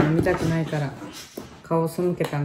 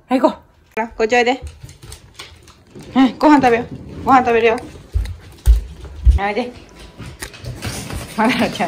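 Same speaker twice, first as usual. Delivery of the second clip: coaxing the pet; 5 semitones higher, faster than usual. Real, not acted.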